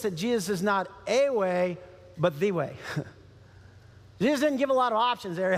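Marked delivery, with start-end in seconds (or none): 2.2-2.8 the dropped low and weighted, THE way not a way; stress on THE. Shown as a demonstration. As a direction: lean on the word the. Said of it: "And notice this".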